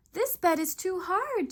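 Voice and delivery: high, loud grumble at the hard bed; grumbling girl. A narrator's reading. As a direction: complain loudly, a high girl's voice. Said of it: "she grumbled".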